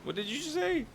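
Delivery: shocked. Real, not acted. Shocked by friend's remark.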